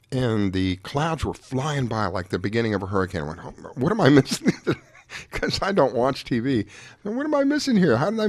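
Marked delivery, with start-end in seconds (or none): none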